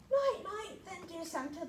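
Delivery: sleepy fairy. Acted. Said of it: "the Tooth Fairy said".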